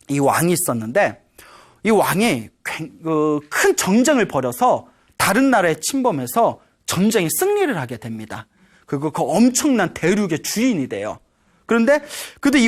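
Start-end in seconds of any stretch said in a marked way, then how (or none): none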